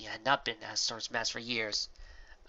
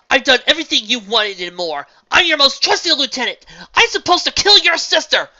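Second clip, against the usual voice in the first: high, loud pleading shout; desperate shout; 19 dB louder than usual. A narrator's reading. Astor shouts at Celestia, refusing to be sent away. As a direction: shout it, begging to stay.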